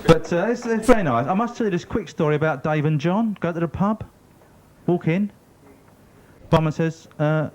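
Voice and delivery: ordinary talk, nothing marked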